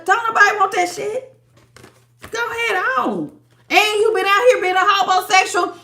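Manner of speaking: high, scornful put-down voice; mock disgust. Tarot reader mocking the collective's ex, with high scornful disdain. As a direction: brush them off with high-pitched disdain. Mocking the person's unwanted advances.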